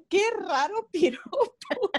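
reacting to a silly meme pickup line, baffled; puzzled mock disbelief. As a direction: drag it out, bewildered by the line. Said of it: "y digo yo".

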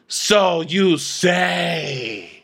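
very slow, drawn-out disdain; Diana. Acted. Diana doubting Sutton, slow theatrical disdain. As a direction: stretch every word dismissively. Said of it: "I'll be Diana".

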